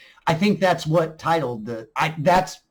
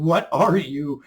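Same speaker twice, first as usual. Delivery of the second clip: mock terror; 5 semitones higher, 6 dB louder than usual.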